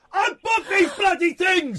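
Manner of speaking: loud, drawn-out outraged yell; exasperated shout. Real, not acted. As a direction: bellow it in mock fury at your co-host. Flustered host shouting at co-host.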